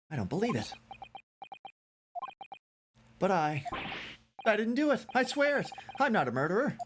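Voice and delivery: high-pitched, whiny protest; bitchy, wimpy defendant. Acted. Accused student swearing he is no murderer, wimpy. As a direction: plead innocence in a wimpy, climbing voice. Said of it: "Every time I use his voice, it gets more and more bitchy and wimpy".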